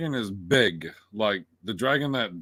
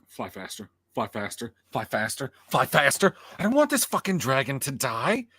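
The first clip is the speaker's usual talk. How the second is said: urgent pleading. Retold or imitated. Retelling how they urged the dragon on, building plea.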